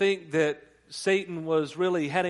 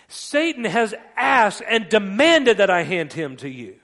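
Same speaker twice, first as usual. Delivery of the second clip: slow, very loud and higher, each key word pounded; preacher acting out a forceful warning; emphatic shouted warning; 4 semitones higher, 10 dB louder than usual. Acted rebuke of Peter.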